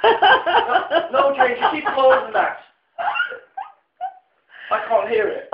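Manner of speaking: put-on father complaining the TV is too loud, in an Irish accent; Irish dad accent. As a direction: imitate his dad's thick Irish accent, laughing. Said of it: "your parents' accents really as strong".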